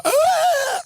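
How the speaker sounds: put-on a high squawk of an untalented kid singing; bad singing squawk. Imitating untalented kids at a show, a high bad-singing squawk. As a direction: screech it like a bad school performer. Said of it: "they're gonna go".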